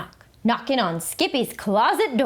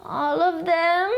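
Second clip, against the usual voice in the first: dismayed whine; 7 semitones higher than usual. A narrator's reading. Skippy dismayed.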